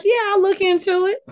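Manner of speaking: high-pitched sarcastic dismissal; sarcastic brush-off. Retold or imitated. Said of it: "I was like".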